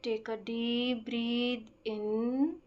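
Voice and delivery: very slow, measured meditation voice with stretched-out words and long pauses; guided breathing meditation. Acted. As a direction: guide them slowly through each breath. (धीमी, शांत ध्यान कराने वाली आवाज़)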